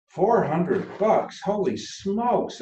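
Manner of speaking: high, slow shout of disbelief; surprised shout. Acted. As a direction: shout it in shock at the price. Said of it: "I shout".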